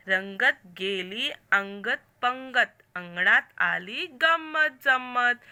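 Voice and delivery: slow, loud, sing-song poem reading; poem recitation. Shown as a demonstration. Teacher reading the Marathi poem aloud to pupils, slow and loud. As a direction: recite each line slowly for children to follow.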